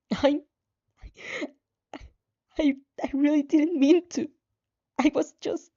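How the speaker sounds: high, stammering tearful voice; broken, sobbing apology; choking on tears. Acted. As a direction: force words out between sobs. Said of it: "You choke in your tears".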